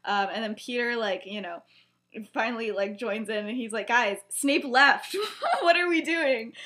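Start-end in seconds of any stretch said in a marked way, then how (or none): 3.9-6.5 louder, higher, with a laugh breaking in; imitating a baffled friend cutting into a fight; retold exasperated plea. Peter's acted exasperated plea.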